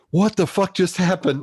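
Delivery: high-pitched, baffled disbelief; freaked out. Retold or imitated. Friend retelling a brush with rock stars, freaked out. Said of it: "Like, he freaked out".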